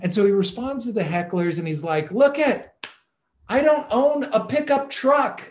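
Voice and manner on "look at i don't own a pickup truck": higher, mock-defensive retort. Seinfeld answering Birmingham hecklers, mocking and pitched up. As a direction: tease the heckler, pitched up and a bit louder. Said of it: "like mocking the fact".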